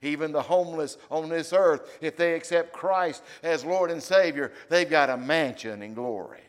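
loud, ringing preacher's voice; preaching. Acted. Pastor preaching to the church, full force. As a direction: preach it out to the congregation.

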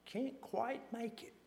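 cancelling caller. Retold or imitated. Speaker cancels on phone.

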